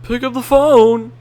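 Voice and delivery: loud, high call out; impatient shout. Real, not acted. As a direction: yell at the ringing phone. Calling out to phone.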